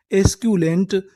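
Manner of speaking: usual speaking voice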